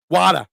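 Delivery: "water" as "wah-tuh", open "ah"; imitating listener's "water". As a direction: mimic "water" with broad "ah" and dropped r. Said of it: "You're saying water".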